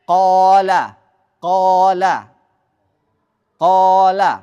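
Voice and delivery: "qala" with a loud, rounded long "qaa" like "qoo-la"; emphatic, full-mouthed "qala"; thick alif. Shown as a demonstration. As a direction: hold a heavy dark first vowel in "qala". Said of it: "maka dibaca tebal".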